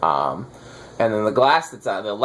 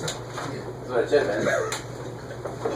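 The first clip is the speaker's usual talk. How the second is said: whispered, slow grumble; muttered aside; 8 dB quieter, much slower than usual. Real, not acted. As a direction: mutter it under your breath. Repeated under breath, slow.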